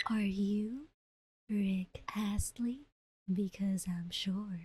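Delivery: slow unvoiced whisper; pickup line whisper. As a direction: whisper it slowly, drawing it out. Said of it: "A S M R". (mabagal na bulong)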